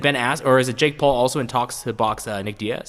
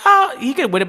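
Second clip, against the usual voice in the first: much higher mock naive voice; 4 dB louder than usual. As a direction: squeak it out like a naive fan. Mocking imitated quote.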